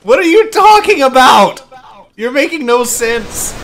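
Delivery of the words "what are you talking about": higher, raised-voice protest; exasperated shout. Real, not acted. Gamer arguing over a fidget spinner, exasperated shout. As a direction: throw it back in exasperation. Arguing back at teammate's taunt.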